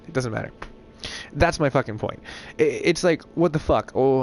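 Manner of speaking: ordinary talk, nothing marked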